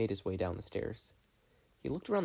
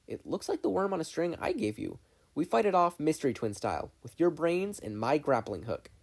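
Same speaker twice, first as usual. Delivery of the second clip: put-on eager girl; 6 semitones higher than usual. Mabel to her twin, high and eager. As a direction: pitch the plan like a keen kid.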